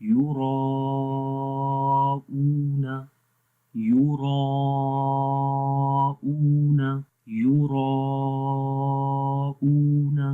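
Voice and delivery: یُراءُونَ drawn out with a heavy را; slow melodic tajweed of یُراءُونَ; heavy ra recitation. Shown as a demonstration. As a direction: chant یُراءُونَ slowly, ra thick. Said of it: "تو پڑھیں گے".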